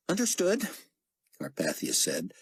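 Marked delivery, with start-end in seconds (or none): 0.1-0.7 too quick. Acted. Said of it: "a little too quickly".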